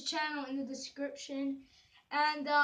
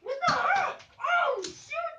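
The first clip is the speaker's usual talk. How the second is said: high startled yelling; surprised yell; 10 semitones higher, 6 dB louder, slower than usual. Real, not acted. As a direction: caught off guard, shout it. Darts flying at him.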